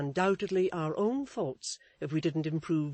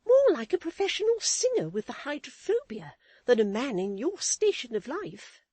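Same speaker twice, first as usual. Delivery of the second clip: much higher, prim lady's voice; Missus Chick's voice; 11 semitones higher, 8 dB louder than usual. A narrator's reading. Wife lecturing her husband, in a high prim voice. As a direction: preach a lesson, high and proper.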